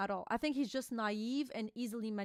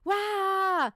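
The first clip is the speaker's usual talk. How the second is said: sudden, higher, louder cry in a put-on voice; short hammed-up shout; mock yell; 7 semitones higher, 7 dB louder than usual. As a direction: belt out a cartoonish roar. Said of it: "And he goes".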